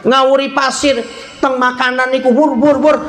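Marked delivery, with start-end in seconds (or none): none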